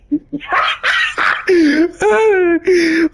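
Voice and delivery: shrieking laugh that tips into a cry; high wailing laugh. Real, not acted. Laughing at a sad game scene, high shrieking laugh. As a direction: laugh so hard it sounds like crying.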